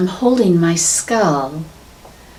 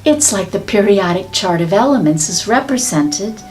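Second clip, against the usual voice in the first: guided meditation. Acted. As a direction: guide them gently into visualization. Leads a meditation.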